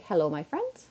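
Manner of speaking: lightly voiced cheerful greeting; a hint of TikToker Linda's sing-song hello; lightly imitated sing-song TikTok opener; imitating Linda. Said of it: "everything she does starts with".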